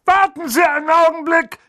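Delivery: high loud bellow; shouting. A narrator's reading. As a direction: bellow at the chimney. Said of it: "brüllte er in Richtung Kamin".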